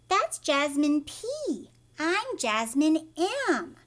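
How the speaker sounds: slow, spelling out P and M; deliberate, patient correction; clear correction. Acted. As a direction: slowly stress the letters P and M. Girl corrects the driver.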